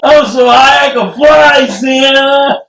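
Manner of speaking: hammed-up giddy reindeer; mock high reindeer.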